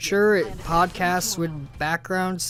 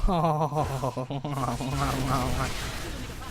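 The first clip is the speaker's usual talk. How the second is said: soft laugh; 2 semitones lower, 5 dB quieter than usual. Real, not acted. Laughs after calling himself asshole.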